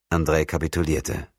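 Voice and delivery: ordinary delivery, nothing marked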